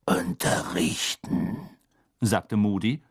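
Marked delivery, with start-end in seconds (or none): 0.0-1.6 low, very slow gravelly rasp; slow croaked reply; raspy deadpan answer. A narrator's reading. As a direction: drag the word out in a throaty growl. Moody answers coolly in his voice.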